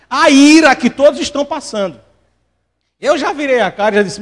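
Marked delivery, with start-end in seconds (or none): none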